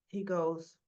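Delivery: normal talking voice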